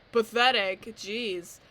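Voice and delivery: loud, scornful inner voice; mocking self-critic. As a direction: jeer at yourself like a bully. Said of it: "'Cause then I'm like".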